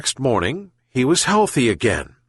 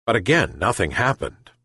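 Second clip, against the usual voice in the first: ordinary delivery, nothing marked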